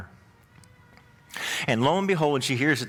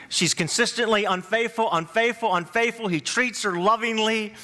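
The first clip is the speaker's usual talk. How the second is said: higher, energetic pulpit delivery telling the Hosea story; fired-up preaching; 8 semitones higher than usual. Acted. Pastor tells his church about Hosea, fired-up preaching. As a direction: preach it out with rising fervor to the congregation.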